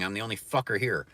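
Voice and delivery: usual speaking voice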